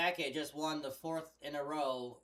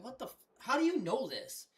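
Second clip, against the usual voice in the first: higher, mock-astonished cry; imitating amazed disbelief; 10 semitones higher, 4 dB louder than usual. Retelling his shock at the store clerk's trivia, amazed. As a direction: act floored by how much he knows.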